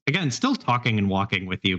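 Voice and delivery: ordinary talk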